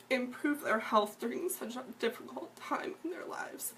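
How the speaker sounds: whispered through tears, high and breaking; barely getting the words out while crying; tearful whisper. Real, not acted. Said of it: "I apologize for being emotional 'cause I know".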